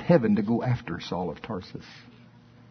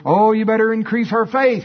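mock pleading disciples; 9 semitones higher, 5 dB louder than usual. Disciples begging for more faith.

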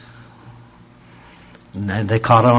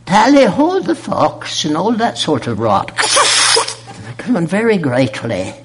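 high, put-on upper-class English accent; mock British oil man, pitched up and pompous; posh British impression; 8 semitones higher than usual. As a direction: ham up a toff British voice. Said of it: "He goes".

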